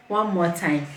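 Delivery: ordinary delivery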